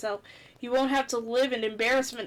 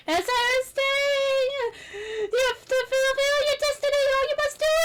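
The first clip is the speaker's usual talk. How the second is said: high spirit voice; 11 semitones higher, 4 dB louder than usual. Acted. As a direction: drag out the prophecy. Prophecy voice through coughs.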